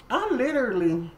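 usual speaking voice

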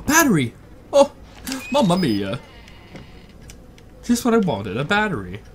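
sarcastic delight; faking delight at gift. Pretend. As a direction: fake excited about a battery. Finds a battery in the game.

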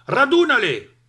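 loud high shout. A narrator's reading. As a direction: yell the order across the field. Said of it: "gridò il contadino". (grido forte del contadino)

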